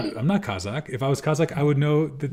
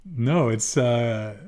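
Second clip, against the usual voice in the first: ordinary talk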